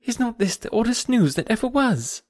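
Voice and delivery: high-pitched, eager question; eager gossip, pitched well up. A narrator's reading. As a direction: share astonishing news in a lifted voice. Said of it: "coming eagerly into the room".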